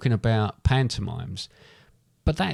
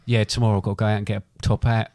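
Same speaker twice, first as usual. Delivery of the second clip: ordinary talk, nothing marked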